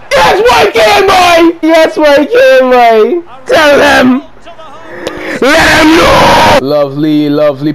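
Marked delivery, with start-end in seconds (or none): 0.1-6.6 ecstatic celebration. Real, not acted. Gamer celebrating his own goal, ecstatic yells. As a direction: scream it out in triumph.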